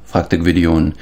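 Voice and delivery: ordinary delivery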